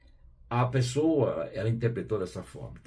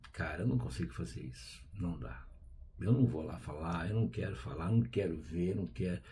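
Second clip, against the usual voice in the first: low, subdued mock refusal; balking quietly, as if to oneself; reluctant refusal; 3 semitones lower, 6 dB quieter than usual. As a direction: grumble a refusal under your breath, lightly acted. Acts out refusing to ask forgiveness.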